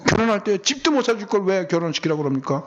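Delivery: high-pitched imitated grumbling; mock a grown child's demanding reproach; retold complaint. Child blames parents over a wedding house, mock complaint.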